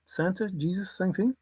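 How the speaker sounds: ordinary talk, nothing marked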